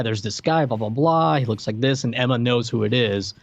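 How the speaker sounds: ordinary talk, nothing marked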